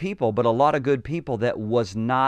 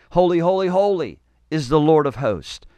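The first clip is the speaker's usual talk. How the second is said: higher, louder proclamation; crying out; 4 semitones higher, 5 dB louder than usual. Acted. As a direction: cry holy out like angels before the throne. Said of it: "And they cried one to another".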